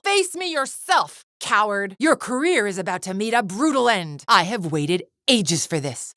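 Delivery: high, strained battle taunt; vengeful, high-pitched outburst; furious challenge. Acted. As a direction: call out a cowardly enemy in rage. Villain challenges rival to fight.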